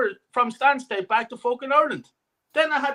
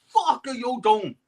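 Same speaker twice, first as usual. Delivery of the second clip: retold scream. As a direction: re-enact screaming at a player's misplaced pass. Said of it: "And I fucking screamed".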